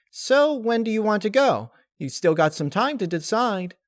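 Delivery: high, bubbly reassurance; high cheerful spirit voice; chirpy Laughter voice. Acted. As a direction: jump in place with eagerness.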